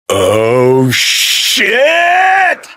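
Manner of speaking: rising "oh" bursting into a high scream; alarmed yell. Acted. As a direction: build into a long, high-pitched holler. Startled outburst during wild ride.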